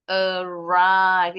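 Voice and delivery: Arrive split, with RIVE loud and long; second-syllable stress. Shown as a demonstration. Teacher drills verb stress, Arrive stressed on RIVE. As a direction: say a... RRIVE, hitting the second syllable hard. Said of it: "Itu udah pasti suku kata kedua".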